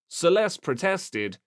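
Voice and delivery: plain reading voice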